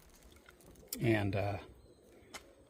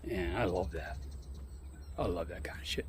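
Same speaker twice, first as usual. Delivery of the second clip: whispering; 5 semitones lower, 11 dB quieter than usual. Real, not acted. Whispered aside about the shop.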